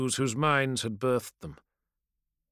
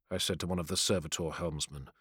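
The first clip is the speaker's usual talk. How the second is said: plain reading voice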